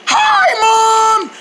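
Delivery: yelling hi up high; loud greeting shout. Acted. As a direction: shout it across the house. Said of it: "Did you hear me the first time I said".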